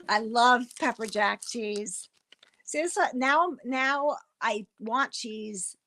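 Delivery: ordinary talk, nothing marked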